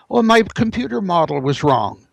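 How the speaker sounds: ordinary talk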